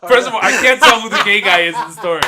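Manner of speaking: loud, laughing through the words. Real, not acted. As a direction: crack up while talking. Laughing at friend's impression.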